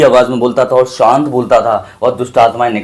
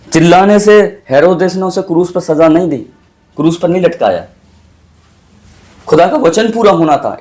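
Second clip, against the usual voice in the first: raised, higher preaching voice pressing each point; fervent preaching; 4 semitones higher than usual. Acted. Pastor urging his congregation, in fervent raised preaching. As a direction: preach it with rising fire to the flock. Pastor's sermon builds in fervor.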